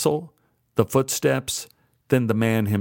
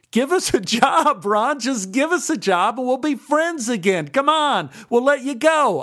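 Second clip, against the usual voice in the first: giggling taunt; laughing; 12 semitones higher than usual. A narrator's reading. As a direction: laugh while making the demand. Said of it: "I laughed".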